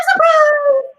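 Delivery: big gasp, then high loud cry; surprised demo. Shown as a demonstration. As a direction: gasp and burst out, acting amazed. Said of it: "We're gonna do surprise".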